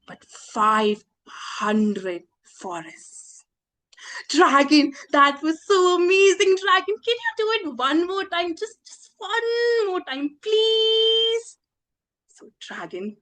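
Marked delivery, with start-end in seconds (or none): 4.3-11.6 high gushing praise sliding into a pleading whine; wheedling excitement. Acted. Princess coaxing the dragon for one more go, pleading. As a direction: beg with long, high-held words.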